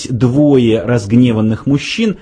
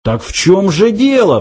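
much higher exasperated cry; exclaimed; 9 semitones higher than usual. A narrator's reading. Fook cries out to the computer, exclaimed. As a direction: cry out the question, pitch jumping up. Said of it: "вскричал Фук".

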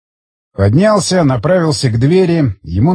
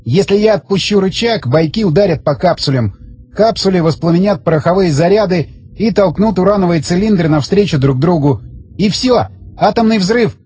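higher-pitched character voice; raised, insistent man's voice; tense bomb holder; 5 semitones higher than usual. Acted. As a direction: explain it with strained urgency, pitched up. Voices Zagorsky explaining the bomb.

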